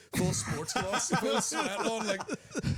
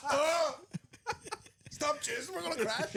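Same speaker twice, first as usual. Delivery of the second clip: panicked; 12 semitones higher, slower than usual. Pretend. Mate panics while flying.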